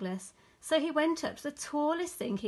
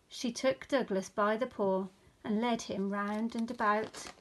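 ordinary delivery, nothing marked

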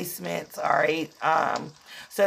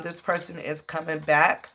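ordinary talk, nothing marked

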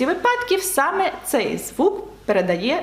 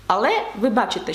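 usual speaking voice

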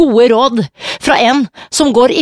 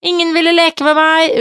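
put-on higher little girl calling down; child calling down; 7 semitones higher than usual. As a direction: play Jenny calling down sadly. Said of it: "roper hun da ned".

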